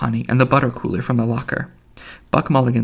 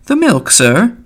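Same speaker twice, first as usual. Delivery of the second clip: put-on high, louder call from an old woman; old milkwoman; 9 semitones higher, 5 dB louder, slower than usual. A narrator's reading. Old milkwoman arriving at the door, calling. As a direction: call out high to announce yourself.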